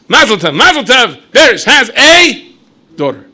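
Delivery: high, loud, slow drawn-out announcement. Acted. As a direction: build it up loudly, then drop the punchline. Announcing another daughter.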